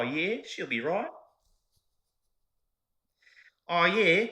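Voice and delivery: loud, high, hammed-up Aussie; Australian accent. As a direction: belt out a broad Australian catchphrase. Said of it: "say it in different ways".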